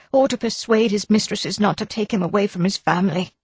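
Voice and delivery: insist, worried and defensive; nervous wife. A narrator's reading. Wife insisting to the inspector she saw nobody. Mrs Gower voiced higher.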